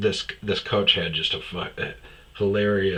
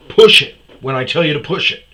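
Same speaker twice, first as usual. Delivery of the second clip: loud, higher, rapid command; bossy retold order; 5 semitones higher, 11 dB louder, faster than usual. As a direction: order them about impatiently.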